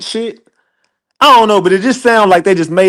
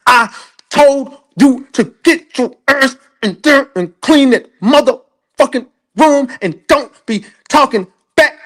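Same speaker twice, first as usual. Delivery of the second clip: angry mama; 8 semitones higher than usual. Retold or imitated. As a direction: play a furious mother mid-whooping, pitched up. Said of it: "how your mama used to whoop your ass".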